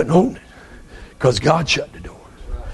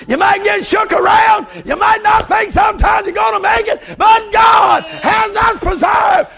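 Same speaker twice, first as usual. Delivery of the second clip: high, shouted revival preaching; preaching at full force; slower than usual. Real, not acted. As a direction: preach it high and drawn out to the whole church.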